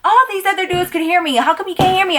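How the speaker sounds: high-pitched, indignant questioning; exasperated complaint. Acted. Waitress annoyed that one customer can't hear her, exasperated.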